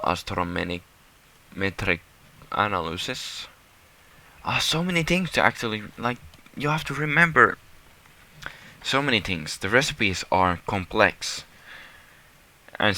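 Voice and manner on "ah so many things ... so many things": fast, high, flustered; rattling off how much to remember; excited overwhelm. Real, not acted. Same words repeated, excited take.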